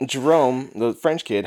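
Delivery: ordinary talk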